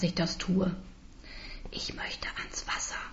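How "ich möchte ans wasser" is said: drängendes, tonloses Flüstern; flüstere es nachdrücklicher; eindringlich geflüstert. Acted. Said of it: "wiederhole ich etwas eindringlicher". (eindringliches Flüstern)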